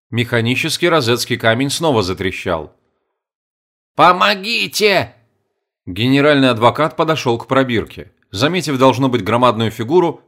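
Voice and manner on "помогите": high, slow, thin insect cry for help; tiny ant voice. Acted. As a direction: squeak it out like a tiny trapped ant. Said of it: "муравей изрёк".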